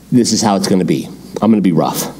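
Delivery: low, rough royal answer. Acted. New king answering the people after three days, low and rough. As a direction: lay down the law in a low voice. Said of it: "the king answered the people roughly".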